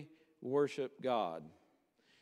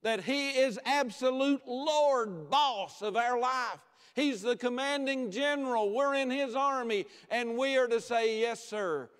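high, loud, emphatic sermon; full-force preaching; 9 semitones higher, 5 dB louder than usual. Real, not acted. Preacher telling the congregation who commands their life, full-force preaching. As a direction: drive each point home to the congregation.

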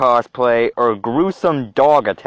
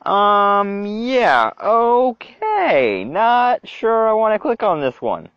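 high, drawn-out uneasy stalling; squeamish, slow, high-pitched wariness; reluctant hesitation; 6 semitones higher, much slower than usual. Real, not acted. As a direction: stretch it out, really not wanting to. Reluctant to click gruesome link.

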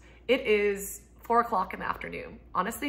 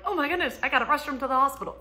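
higher, quickened panicked rush; mock panic; 7 semitones higher, 4 dB louder, faster than usual. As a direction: imitate rushing to the hospital. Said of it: "I'm like".

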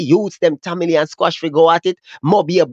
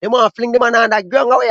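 ordinary talk

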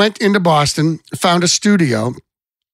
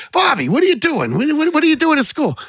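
put-on much higher Boston-accent dad, quizzing; dad's Boston accent; 11 semitones higher than usual. Boston father questioning his son about college, in a higher Boston accent. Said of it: "his Boston accent".

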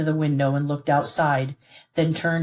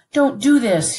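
high, loud desperate plea; pleading; 9 semitones higher, 6 dB louder, slower than usual. A narrator's reading. Son begs his father not to leave him, pleading. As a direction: beg him not to go through with it. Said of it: "he pleaded".

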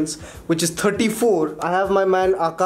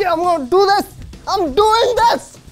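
strained triumphant yell; 14 semitones higher, 4 dB louder than usual. Real, not acted. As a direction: drag it out, straining hard. Straining during toilet roll attempt.

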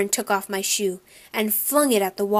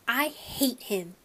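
angry; 2 semitones higher, 6 dB quieter, slower than usual. A narrator's reading. Boy tells mother about brother, bitterly angry. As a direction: drop softer and spit it out slowly. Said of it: "You're angry".

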